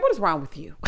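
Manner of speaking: normal talking voice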